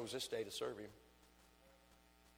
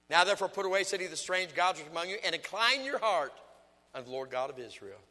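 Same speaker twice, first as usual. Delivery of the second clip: scripture reading; 7 semitones higher, 10 dB louder than usual. Acted. Reads scripture aloud forcefully.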